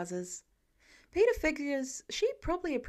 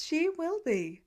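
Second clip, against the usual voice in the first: higher Pepper voice, dry; Pepper voiced; 6 semitones higher, slower than usual. A narrator's reading. Pepper catching Tony out, higher and dry. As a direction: stress she, knowingly.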